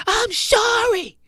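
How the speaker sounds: very high, slow, loud mock scream; imitated angry scream. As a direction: yell the apology like you mean the opposite. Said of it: "she starts screaming through the window".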